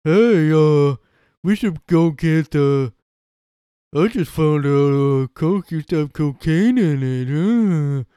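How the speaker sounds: big slow oaf with long dopey "uh"s; dumb guy voice. Acted. Clueless guy sharing a discovery about Coke, dumb guy voice. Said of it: "that dumb guy voice".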